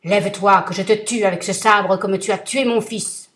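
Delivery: thundering vengeful genie; terrible genie voice. Acted. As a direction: roar it down at him like a giant. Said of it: "d'un ton de voix terrible".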